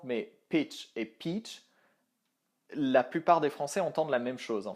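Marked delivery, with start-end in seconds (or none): 0.0-1.6 slow careful pitch then long-vowel peach; English pitch and peach. Shown as a demonstration. Teacher contrasting short pitch and long peach for French learners. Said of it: "je vais essayer de prendre un exemple".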